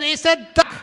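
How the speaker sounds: very high, squeaky comic retort; mock squabbling. Husband fires back at wife over the bird, squeaky mock retort. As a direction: pitch it way up, stubbornly. Acted couple's escalating argument.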